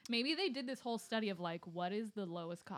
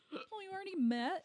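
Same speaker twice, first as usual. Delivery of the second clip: gasp of surprise; 11 semitones higher than usual. Real, not acted. Mock shock at the show.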